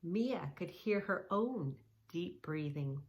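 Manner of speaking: normal talking voice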